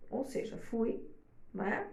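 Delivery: usual speaking voice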